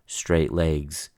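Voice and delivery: usual speaking voice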